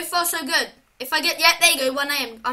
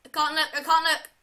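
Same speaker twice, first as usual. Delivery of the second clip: usual speaking voice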